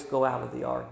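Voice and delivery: hushed, tender "Go out of the ark"; soft divine voice. Acted. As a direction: speak "Go out of the ark" gently and low, as a quiet call. God's voice heard again, softly.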